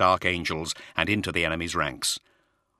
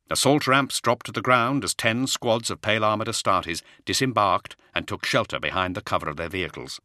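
usual speaking voice

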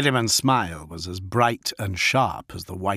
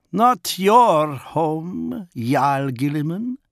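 higher, slow, proud wolf chieftain; Fafnir the wolf lord; 7 semitones higher, 4 dB louder, much slower than usual. Acted. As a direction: speak as the feral wolf lord, measured. Wolf leader Fafnir's reply.

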